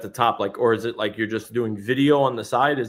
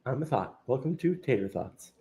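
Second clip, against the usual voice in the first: hushed thought voice; 10 dB quieter than usual. Acted. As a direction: introduce yourself softly as an inner thought. Imitating the soft thought voice just played.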